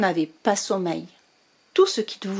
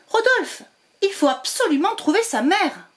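higher urgent plea to the cat; 8 semitones higher than usual. Acted. Witch urging her cat to find the mother, higher urgent voice. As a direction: press the cat to act now.

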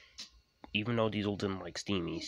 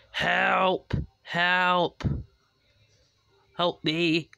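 high, loud yells; loud, high, desperate calls; crying for help; 10 semitones higher, 10 dB louder, much slower than usual. A narrator's reading. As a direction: shout for rescue in panic. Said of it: "cried James".

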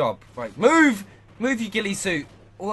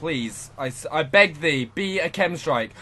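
usual speaking voice

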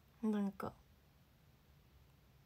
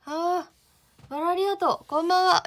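excited greeting; 7 semitones higher, 11 dB louder than usual. Real, not acted. Excited thanks to viewers' gifts.

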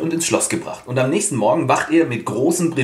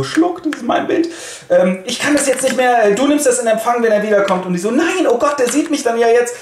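panicky; 10 semitones higher than usual. Acted. Women panicking at court.

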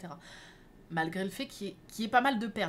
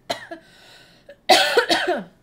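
loud choking cough; hacking cough, choking; coughing fit; 9 semitones higher, 16 dB louder than usual. Real, not acted. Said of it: "je m'étouffe".

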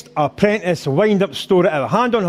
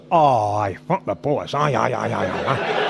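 low muttered run of ayes, tailing softer; agreeable wee boy; 9 semitones lower, 4 dB quieter than usual. Acted. Naive wee boy agreeing to prank.

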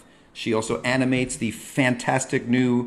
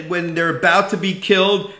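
usual speaking voice

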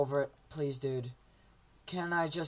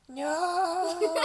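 very high drawn-out cry; mock wail; 11 semitones higher than usual. Game character meeting doom, high mock wail. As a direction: squeal it out in comic despair.

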